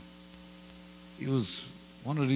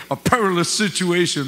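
a higher, louder mock-sophisticated "A perilous situation."; mock grand; 7 semitones higher, 10 dB louder than usual. Shown as a demonstration. Preacher showing off a big word, mock grand. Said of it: "Sound great".

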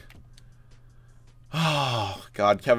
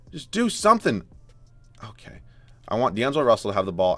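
ordinary talk

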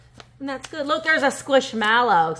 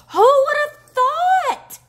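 much higher, louder, slow and drawn out; amazed squeal; 10 dB louder, much slower than usual. Real, not acted. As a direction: squeal it out in delight. Amazed by upcycled necklace.

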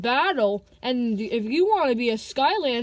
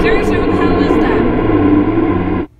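ghost voice; 9 dB louder than usual. Acted. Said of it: "another part of the ghost tape".

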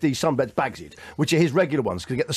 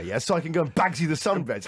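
normal talking voice

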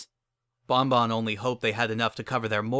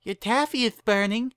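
high, lisping little filly voice; lispy filly; 11 semitones higher, 3 dB louder than usual. A narrator's reading. Curly-haired filly calls her boss from the kitchen, lispy voice. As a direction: pipe up with a childlike lisp. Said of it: "came a lispy voice from the kitchen".

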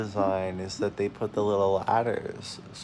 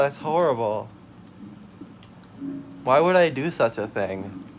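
usual speaking voice

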